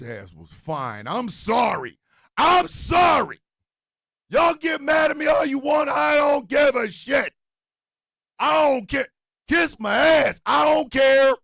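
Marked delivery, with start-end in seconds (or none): 2.4-3.4 higher, louder and slow; shouted fake apology. Real, not acted. 4.3-11.3 higher, louder, daring the crowd; defiant shout. Real, not acted. Defiant rant at audience.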